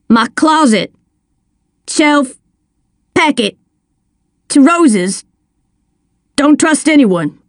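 thin, broken voice over a bad line; words cutting in and out on a phone; scratchy phone line. A narrator's reading. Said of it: "came through scratchy and thin".

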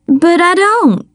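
put-on high-pitched girlish wail. A narrator's reading. Daughter to her demanding mother, wailing. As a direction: wail it in protest, pitch climbing. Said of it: "the girl wailed".

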